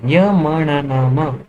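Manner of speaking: य म न न म spaced out very slowly; slow syllable demo. Shown as a demonstration. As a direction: sound each of य म न न म separately, slowly. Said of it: "फिर आता है लास्ट वर्ण". (एक-एक अक्षर बहुत धीरे)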